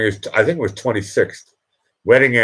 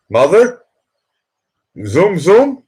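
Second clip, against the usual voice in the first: calling out; 10 semitones higher, 5 dB louder, slower than usual. Real, not acted. Calling out to his mother.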